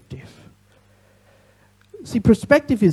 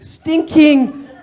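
"stinking" said louder and higher with a laugh; corrected word, with a laugh; 5 semitones higher, 5 dB louder than usual. Shown as a demonstration. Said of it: "not stunking".

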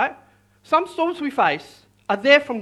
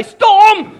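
loud, high shout full of attitude; 9 semitones higher, 10 dB louder than usual. Shown as a demonstration. Preacher coaches congregation to command the storm, with attitude. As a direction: shout it like you expect obedience. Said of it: "That's a bit of attitude".

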